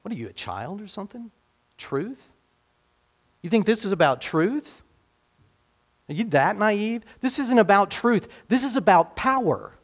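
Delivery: high, mocking cynical governor; scornful Pilate. Pilate mocking Jesus as a naive child, high and scornful.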